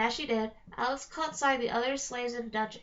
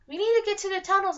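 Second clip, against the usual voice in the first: urgent; 9 semitones higher, 7 dB louder, faster than usual. A narrator's reading. Man urges escape.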